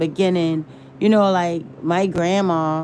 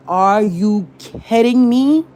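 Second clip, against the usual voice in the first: disbelief; 3 semitones higher, 4 dB louder than usual. Retold or imitated. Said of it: "happening in my mind".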